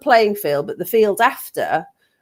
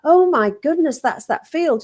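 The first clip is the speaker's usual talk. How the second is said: surprised; 8 semitones higher than usual. Retold or imitated. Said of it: "I went".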